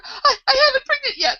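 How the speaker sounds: very high, loud stammered wail; screaming. A narrator's reading. The Queen answers Alice mid-screaming, wailing high. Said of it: "Her screams were so exactly like".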